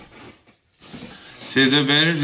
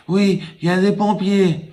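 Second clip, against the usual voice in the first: put-on high little boy voice; 8 semitones higher than usual. Small boy telling caller everyone is busy, high child voice. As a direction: answer like a small child, matter-of-fact.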